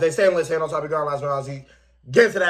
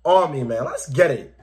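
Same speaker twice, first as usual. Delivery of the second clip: ordinary talk, nothing marked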